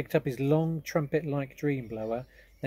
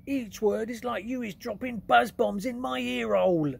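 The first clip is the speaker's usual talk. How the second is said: much higher, louder giant pleading; 11 semitones higher, 7 dB louder than usual. Acted. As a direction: beg loudly as the giant. BFG pleads.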